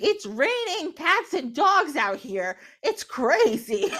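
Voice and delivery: high, drawn-out comic exclamation; exaggerated storyteller excitement; acted alarm. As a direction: sell a fake downpour with big stretched-out words. Acting spider's fake rainstorm alarm.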